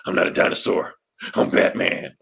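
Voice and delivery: low, whispery tough-guy Batman. Acted. Dinosaur Batman insisting he's no dinosaur, trying to sound tough. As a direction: growl it like you're trying to be tough. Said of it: "the guy trying to be tough".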